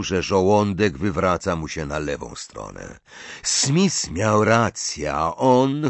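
ordinary delivery, nothing marked

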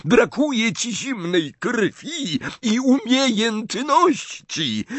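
high, drawn-out jeer; shouted taunt. A narrator's reading. Snape belittling Harry in the duel, taunting shout. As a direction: throw the insult out slowly, pitched high.

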